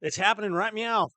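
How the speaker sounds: excited. Real, not acted. Cat pun said excitedly.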